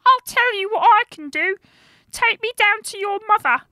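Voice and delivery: very high, loud little creature; brownie voice. A narrator's reading. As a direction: squeak it out like a story brownie. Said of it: "the brownie thought for a while and said".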